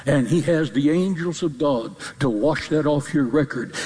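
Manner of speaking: normal talking voice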